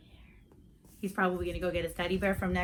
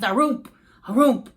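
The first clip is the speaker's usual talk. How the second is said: spooky thumping; 2 semitones higher, 9 dB louder than usual. Acted. Ghost's clunky sounds imitated.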